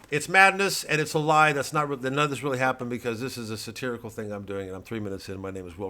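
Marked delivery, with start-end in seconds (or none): 0.1-1.5 loud, high, ranting right-wing pundit; blustering pundit. Acted. Satirical pundit ranting to his audience, blustering loud. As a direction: bluster like a cable news blowhard.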